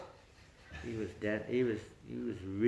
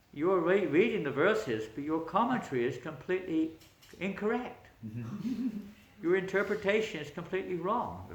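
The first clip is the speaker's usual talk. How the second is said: bold correction; 9 semitones higher, 6 dB louder than usual. Acted. Newcomer corrects the pandit.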